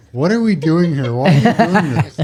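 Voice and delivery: retelling mock outrage at the partner's swinging mic, laughing; exasperated, laughing. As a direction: throw up your hands at him, half laughing.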